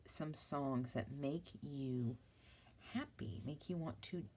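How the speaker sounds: usual speaking voice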